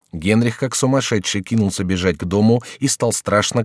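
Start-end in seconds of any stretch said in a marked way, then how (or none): none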